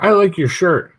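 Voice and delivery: put-on high, loud seven-year-old voice; small boy squeaking out a compliment; little kid. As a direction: pipe up like an eager little kid. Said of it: "he was like".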